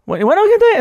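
high, loud mock version of his own greeting; imitating the imitator. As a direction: parody yourself as the fan does. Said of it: "hace mi".

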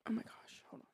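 hushed whisper. Real, not acted. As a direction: whisper it breathily. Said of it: "Why was I whispering?".

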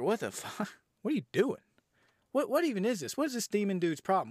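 high-pitched incredulous questions; exasperated bafflement. Retold or imitated. Reviewer baffled by the demon's rules, high exasperation. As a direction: pitch it up in comic disbelief. Voices own baffled reaction to plot.

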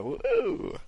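high, soft mock cheer; imitated crowd whoop. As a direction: mimic a crowd going "woo". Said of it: "it's like".

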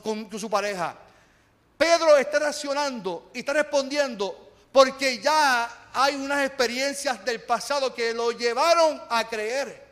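ordinary talk, nothing marked

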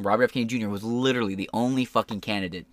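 usual speaking voice

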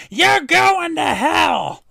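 high, slow, drawn-out comic screech; hammed-up, high-pitched slow yell; Gilbert Gottfried. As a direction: shout it as an over-the-top impression. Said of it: "Gilbert, what's going on?".

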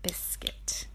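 hushed whisper of the title; whispered book title. Acted. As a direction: breathe the name like a secret. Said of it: "reading title to child".

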